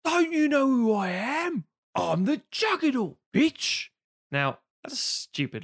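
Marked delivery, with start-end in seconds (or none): 0.0-3.9 high, drawn-out mock villain shout; a put-on movie villain's taunt; Juggernaut movie quote. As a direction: ham up the Juggernaut's big line. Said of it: "and he says".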